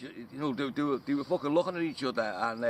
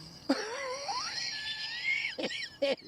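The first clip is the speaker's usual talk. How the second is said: high laughing shriek; 3 dB quieter than usual. Real, not acted. Laughs after singing.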